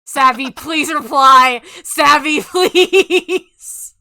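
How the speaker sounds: loud laughing plea, drawn out; excited pleading. Real, not acted. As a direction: beg loudly through laughter. Begging for a reply.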